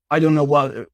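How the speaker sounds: ordinary delivery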